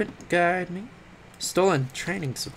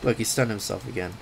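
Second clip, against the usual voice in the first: ordinary talk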